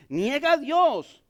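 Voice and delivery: lento y más fuerte, como orden de la esposa; esposa de Job. Retold or imitated. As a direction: arrastra "Niega a Dios" alto y fuerte. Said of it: "su esposa le dice". (la esposa a Job, lenta y fuerte: niega a Dios)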